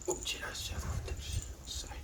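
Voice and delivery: unvoiced whisper to the pet; whispered coo to the dog. Real, not acted. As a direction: whisper a cute oopsie.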